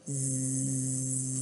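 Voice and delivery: very quiet sleepy zzz; a sleeping cat's hum; soft snore. Acted. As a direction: drift off into a snore. Cat falls asleep at end.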